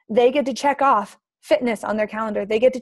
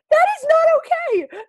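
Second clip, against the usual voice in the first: much higher, slow and loud in outrage; outraged mom; 5 dB louder, slower than usual. Retold or imitated. Mother protecting her kids, slow loud outrage. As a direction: spell out each word in outrage. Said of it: "I was so mad".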